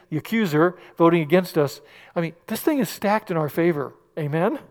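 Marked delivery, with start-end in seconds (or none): none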